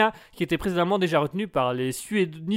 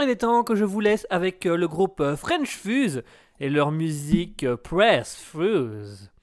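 normal talking voice

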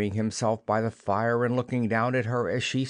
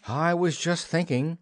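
higher-pitched, mildly nervous; a little scared; 7 semitones higher than usual. A narrator's reading. Peter confesses his doubts to Wendy, a little scared. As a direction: say it with a touch of fright. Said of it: "a little scared".

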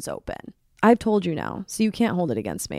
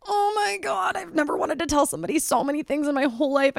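much higher, bursting to speak; squealing frustration; 8 semitones higher than usual. Retold or imitated. Wanting to warn the girl next to her, high bursting frustration. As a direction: strain to hold it all in.

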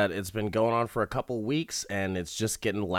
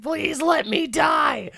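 drawn-out high mock scream; impatient begging; 5 dB louder, slower than usual. Condemned man bursts in begging to die, hammed-up scream. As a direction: shriek it like a desperate cartoon plea. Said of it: "the guy gets impatient".

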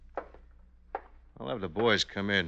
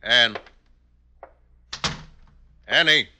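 calls her name loudly through the house; calling out; 3 semitones higher, 8 dB louder than usual. Acted. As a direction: call out to her, loud.

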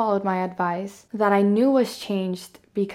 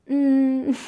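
choked hum; 5 semitones higher, 6 dB louder than usual. Retold or imitated. Reenacting choking up, then laugh.

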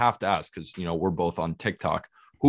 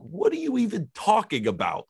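incredulous retort; 12 semitones higher than usual. Retold or imitated. Reacting to hater comment.

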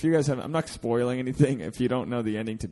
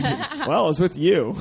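talk show host; 5 semitones higher, 5 dB louder, slower than usual. Retold or imitated. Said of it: "I feel like Regis and Kathy Lee".

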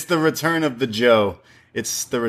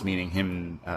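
normal talking voice

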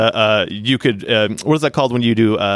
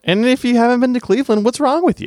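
mock outrage; 11 semitones higher than usual. Real, not acted. Mock outrage plugging Cleveland.

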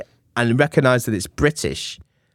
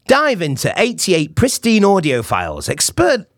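excited, pitched-up advert read; cheesy ad style; 6 semitones higher than usual. Shown as a demonstration. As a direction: sell it big, climbing high at the end. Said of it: "I literally recorded it like this".

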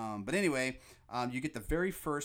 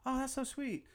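put-on much higher girly quote; her reply. Fan quoting the wife's reply, lightly voiced. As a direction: lightly voice the wife. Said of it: "she's like".

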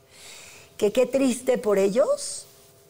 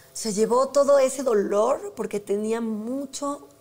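ordinary delivery

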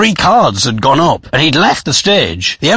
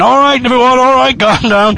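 raised, high-pitched call for order; imitated MC shout; 9 semitones higher than usual. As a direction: shout over a rowdy room. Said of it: "the M C shouting".

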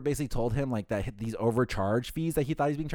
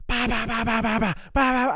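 very high, loud run of nonsense syllables; mock screaming rant; 12 semitones higher, 7 dB louder than usual. Hotel caller screaming at the agent, mock rant in bahs. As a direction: imitate a caller yelling nonstop. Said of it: "he just screams at me".

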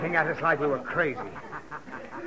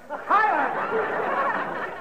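shouting; 13 semitones higher than usual. Acted. Father snaps at nagging child.

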